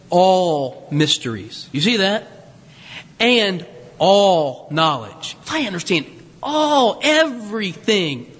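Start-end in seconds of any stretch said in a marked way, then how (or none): 0.1-5.4 ALL drawn out slow and low; emphatic reading. Shown as a demonstration. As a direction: lean hard on all, slowly. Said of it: "notice the emphatic".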